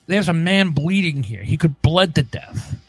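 usual speaking voice

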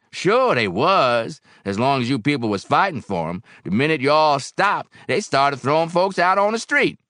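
loud, high-pitched complaint; indignant barkeep Barrel House. A narrator's reading.

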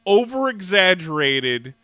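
ordinary talk

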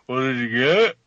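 a lightly voiced line from the insurance ad; quoting the ad. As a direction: toss it off like the actor in the ad. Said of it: "that's what Matthew says".

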